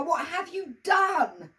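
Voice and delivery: high, slow, loud screamed question; screaming. Acted. Emma screaming at Billy over the bat, slow and shrill. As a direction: drag it out in a shriek. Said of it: "screamed Emma".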